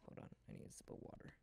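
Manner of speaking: hushed late-night whisper. Real, not acted. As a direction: whisper so parents stay asleep. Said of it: "I need to be quiet".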